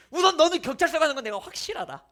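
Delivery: pastor's loud joking reply to students; firm retort. Retold or imitated. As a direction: hit them with the blunt answer. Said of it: "전 말하죠".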